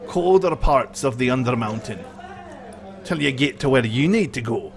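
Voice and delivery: high-pitched dwarf character voice; dwarf guide. Acted. Dwarf tells the route.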